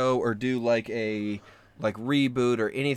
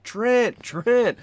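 high, loud searching shout; imitated calling out; 11 semitones higher, 5 dB louder than usual. Imitating producers searching the woods for Trent, calling out. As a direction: call a name out across the woods. Crew calling for lost man.

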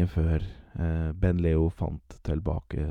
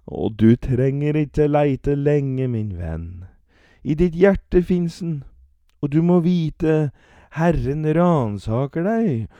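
higher, louder sermon cadence; preaching old man; 9 semitones higher, 6 dB louder than usual. Acted. Old man preaching about God.

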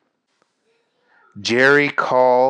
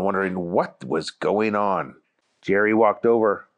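plain reading voice

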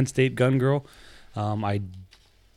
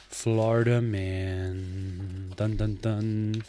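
dramatic segment intro; much slower than usual. Acted. Segment intro with sung sting.

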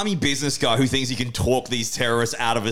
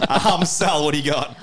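high voice with laughter in it; laughing; 7 semitones higher, 3 dB louder than usual. Real, not acted. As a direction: move on, still laughing. Moves on while laughing.

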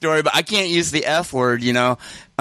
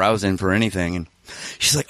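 normal talking voice

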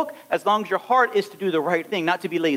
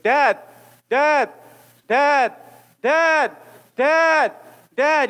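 put-on kid calling dad; 5 semitones higher, much slower than usual.

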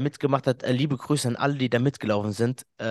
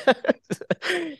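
high burst of laughter. Real, not acted. Laughing about the extra five kilometres.

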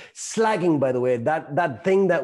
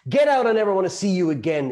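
a touch louder and higher, scolding; critical parent; 6 semitones higher, 4 dB louder than usual. Shown as a demonstration. Trainer demonstrating a critical parent, slightly louder scolding. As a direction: demo a sharp critical-parent line. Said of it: "It sounds very critical parent".

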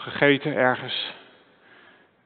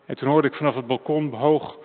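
normal talking voice

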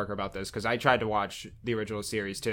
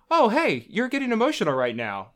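high, louder brain voice butting in; chirpy inner voice; 10 semitones higher, 5 dB louder than usual. Acted. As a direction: pipe up like an intrusive thought. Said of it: "and then my brain goes".

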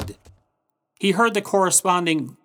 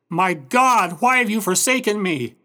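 higher, moderately raised cry of anguish; 6 semitones higher, 4 dB louder than usual. Retold or imitated. Jesus on the cross to God, anguished cry. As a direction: cry out in mild anguish, pitch lifted. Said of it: "crying out in anguish".

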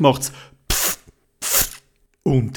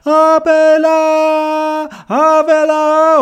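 long, slow high call, much louder; 12 semitones higher, 6 dB louder, much slower than usual. Acted. Theobald calls to be lowered, long and loud. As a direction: stretch the call down to the crane man.

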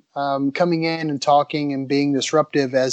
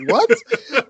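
high, loud laughing what; startled what; 9 semitones higher, 4 dB louder than usual. Retold or imitated. Designer stopped at the door by the bouncer, startled high what. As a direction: blurt it out surprised. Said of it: "He's like".

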